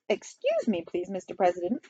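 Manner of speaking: put-on soft, polite Chinese-accented voice; timid, quiet interjection; meek official. Assistant premier meekly interrupts.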